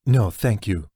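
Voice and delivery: a curt, sharp no thanks; sharp refusal. Acted. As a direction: cut the offer off sharply. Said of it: "Jack said sharply".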